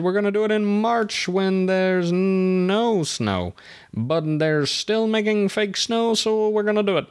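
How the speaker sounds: sing-song it high, like a silly plan; mock-naive determination. Retelling the group's March snow plan, mock-naive.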